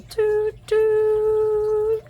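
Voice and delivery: high whistle-like toot; train whistle. Acted.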